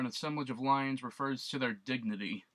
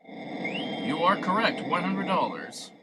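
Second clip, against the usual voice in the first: booming quiz host announcing a win, slow and louder; game show host Alex; 5 semitones higher, 5 dB louder, slower than usual. Pretend. Player announcing his own correct answer like a host. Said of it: "Starting to sound like Alex now".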